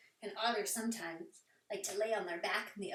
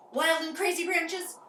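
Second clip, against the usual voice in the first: louder and higher, playful; wild and excited; 7 semitones higher, 9 dB louder than usual. Shown as a demonstration. Teacher showing wild branches to kids, excited. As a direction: burst out wild.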